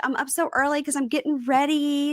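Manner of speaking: high, giddy, squealing excitement; bubbly girly voice. Retold or imitated. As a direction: gush like a lovestruck teen. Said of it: "She's like".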